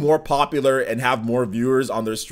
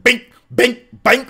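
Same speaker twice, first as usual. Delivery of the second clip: high, loud hype catchphrase in a put-on voice; Nairo's hype voice; 11 semitones higher, 9 dB louder, slower than usual. Streamer imitating another streamer's catchphrase, elevated hype voice. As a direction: punch out each word, high and loud. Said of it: "He's always like".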